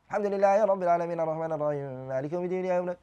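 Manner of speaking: fast, hurried Quran recitation; rushed recitation. Shown as a demonstration. Teacher showing students the wrong, rushed recitation. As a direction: rattle off the verses.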